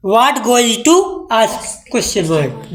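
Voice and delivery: every word slow and spaced apart; slow dictation. Shown as a demonstration. Teacher dictating the English to her class, slow. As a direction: dictate it word by word so they can write. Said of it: "पूछने में क्या जाता है".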